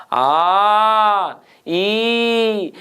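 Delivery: आ and ई each held long and steady; prolonged vowels. Shown as a demonstration. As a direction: stretch आ then ई. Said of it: "इतना लंबा लंबा जब बोल रहे थे आप". (आ और ई को लंबा खींचकर)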